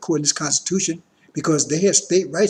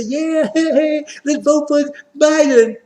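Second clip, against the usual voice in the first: mock cheerful voter; 8 semitones higher, 5 dB louder, slower than usual. Mocking voters' cheerful reply.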